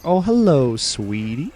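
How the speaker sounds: high, loud, sugary Southern hello; sweet greeting. Retold or imitated. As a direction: coo at the newcomer like a tea-shop auntie. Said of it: "and she's like".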